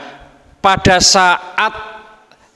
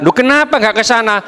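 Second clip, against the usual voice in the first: higher, fast puzzled question; surprised husband; 7 semitones higher, much faster than usual. Acted. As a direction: fire the question back in disbelief. (suami bertanya cepat dengan nada heran)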